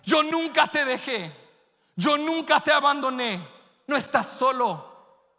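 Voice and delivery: high, loud, half-sung preaching; preaching at full force. Acted. Pastor preaching to the church at full force, high and loud. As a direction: lift the church up and pour it out.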